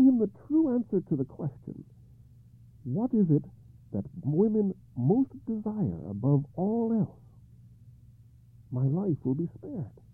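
high, troubled king; King Arthur voice. Acted.

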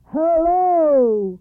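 high, very loud booming hello; thunderous voice. Acted. Mountain booming a greeting to father and daughter. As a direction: boom it like a mountain. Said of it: "in a thunderous voice".

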